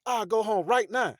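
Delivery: loud, high-pitched imitated yell; grill man's outburst. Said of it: "when you hear your grill man over there".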